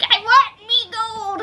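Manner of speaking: high, slow mock leprechaun growl. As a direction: drag it out like a greedy leprechaun. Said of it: "he's like".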